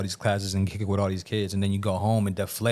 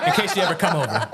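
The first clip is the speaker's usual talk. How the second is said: laughing; 11 semitones higher, 7 dB louder, faster than usual. Real, not acted. Joke amid laughter.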